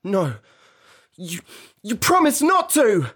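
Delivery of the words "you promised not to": loud, high accusing cry; upset boyish protest. A narrator's reading. Peter accuses Wendy of breaking her promise, loud high protest. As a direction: protest loudly, voice pitched high.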